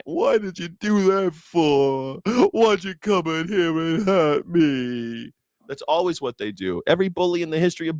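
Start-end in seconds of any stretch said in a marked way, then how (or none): 0.0-5.3 slow, high whimpering complaint; cowardly lion crying. Retold or imitated. Cowardly lion after the boop, whiny high whimper. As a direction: whine like a hurt crybaby. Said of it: "starts crying".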